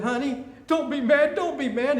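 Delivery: wife calling after her husband, pleading not to be mad. Acted. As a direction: play the anxious wife begging him to stay.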